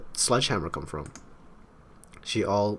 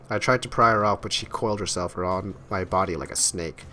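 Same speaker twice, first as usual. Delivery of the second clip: ordinary talk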